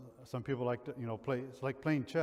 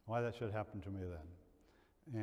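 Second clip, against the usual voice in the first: ordinary talk, nothing marked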